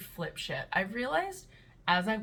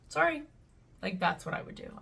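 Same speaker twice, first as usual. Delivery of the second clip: ordinary talk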